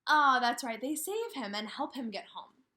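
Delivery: ordinary talk, nothing marked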